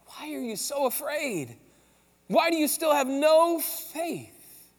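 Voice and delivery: drawn out slowly, each word weighed; slow pointed question. Acted. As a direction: press the question home, slow and searching. Jesus cutting to the core.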